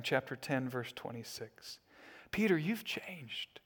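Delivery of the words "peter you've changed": breathy slow whisper. Pretend. People marveling at Peter, slow whisper. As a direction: hush it, barely voiced.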